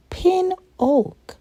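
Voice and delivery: ordinary delivery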